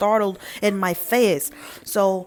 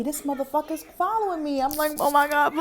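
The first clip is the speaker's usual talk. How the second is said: panicked; 6 semitones higher than usual. Retold or imitated.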